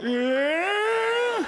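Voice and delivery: a sudden high-pitched, strangled yeah; stunned high yelp of a yeah; strange high yeah. Retold or imitated. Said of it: "That was the noise I made".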